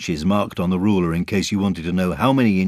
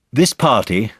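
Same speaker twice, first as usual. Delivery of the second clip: higher, louder announcing voice; lightly voiced Christopher Robin; 6 semitones higher, 6 dB louder, slower than usual. Christopher Robin opening his party speech, announcing.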